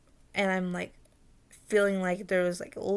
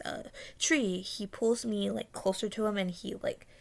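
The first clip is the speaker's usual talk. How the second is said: ordinary delivery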